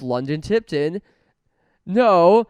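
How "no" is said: sudden loud no. Real, not acted.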